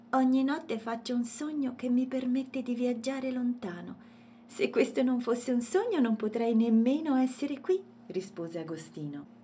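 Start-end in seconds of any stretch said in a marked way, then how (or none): 0.1-7.8 high, earnest storybook voice; little elephant explaining. A narrator's reading. Elephant explains his dream to Matilde, lively. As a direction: explain eagerly, pitched up.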